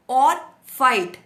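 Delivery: Fight hit loud and slow; stress on fight. Real, not acted. Stresses fight over flight. ("Fight" पर ज़ोर, धीमा और ऊँचा)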